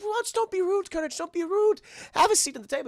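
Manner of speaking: high, fussy cartoon voice; chiding Muriel impression. Muriel correcting rude dog at table, chiding.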